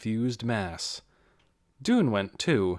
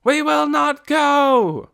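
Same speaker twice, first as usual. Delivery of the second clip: very slow, high, loud scream; crowd screaming; 9 dB louder, much slower than usual. A narrator's reading. Crowd screaming defiance back at Ben. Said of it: "screamed the crowd".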